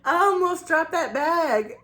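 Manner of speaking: high-pitched imitation of his exclamation; restaurant worker's exclamation. Imitating the guy handing over the bag, high exclaiming. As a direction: pitch it way up, mimicking him while tickled. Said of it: "I'll try to do it as best as I can".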